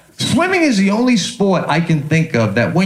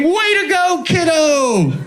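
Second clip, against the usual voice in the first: high, bright-toned cheer from the pool edge; encouraging coach; 8 semitones higher, slower than usual. Retold or imitated. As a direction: hype the kid up like a peppy coach. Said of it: "the coach all encouraging".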